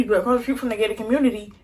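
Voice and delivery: hurried, run-together delivery; rushed. Real, not acted. Storyteller racing through the neighborhood layout, rushed. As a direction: rattle it off quickly. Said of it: "Let me talk slower".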